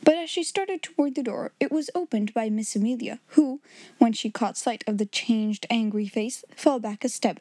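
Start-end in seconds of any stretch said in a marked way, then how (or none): none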